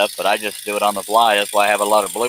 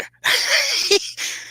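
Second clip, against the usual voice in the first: shrill giggle burst; high squeal of laughter. Real, not acted. Vlogger cracking up at his own joke, high laugh.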